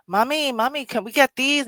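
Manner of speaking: imitating children asking mommy for decorations; pleading kids. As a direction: beg like an excited little kid. Children begging mother at checkout.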